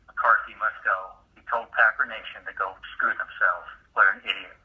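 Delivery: ordinary talk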